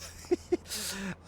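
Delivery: high burst of laughter. Real, not acted. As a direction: laugh out loud at yourself. Laughing at own naivety.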